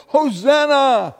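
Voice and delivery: high, loud, drawn-out cheer; long, soaring cry of acclaim; crowd shout. Acted. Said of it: "with a noisy crowd shouting".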